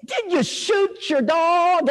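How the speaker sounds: high, drawn-out mock shout; hammed-up, slow indignant holler; hostile neighbor. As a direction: demand it like a cranky old neighbor. Neighbor demands of the visitor.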